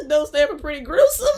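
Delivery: words through laughter; laughing. Real, not acted. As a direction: laugh while saying it. Laughing at the nickname.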